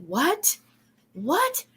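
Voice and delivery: rising, disbelieving what; baffled double-take question; surprised. Retold or imitated. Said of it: "And I'm like".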